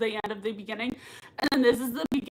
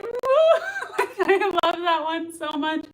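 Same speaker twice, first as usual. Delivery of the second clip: high laughing woo, much higher and louder; imitated whoop; 6 semitones higher, 5 dB louder, much slower than usual. Imitating Koenig's cheer, high laughing whoop. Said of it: "Koenig is like".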